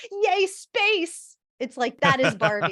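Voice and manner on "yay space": high, bright mock squeal of joy; excited cheer. Barbie seeing astronaut Barbies, imitated as a high, gleeful cheer. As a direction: cheer it like a wide-eyed Barbie. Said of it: "she just goes".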